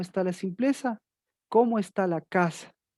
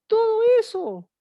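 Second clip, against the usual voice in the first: sudden high, loud cry; preacher's cry; 14 semitones higher, 6 dB louder than usual. Acted. Preacher crying out to the congregation at the climax, high and loud. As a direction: burst out at the flock. Peak of sermon rebuke.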